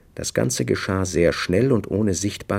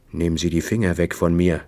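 plain reading voice

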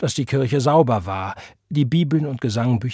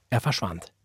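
usual speaking voice

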